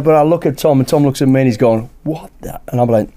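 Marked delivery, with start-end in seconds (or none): 2.0-2.6 imitating a stunned whispered what the; retold whisper. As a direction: mouth it under your breath in disbelief. Tom mouths reaction outside hotel.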